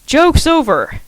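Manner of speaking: loud, high call into the room; yell. A narrator's reading. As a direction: shout to flush out the prankster. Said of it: "she yelled".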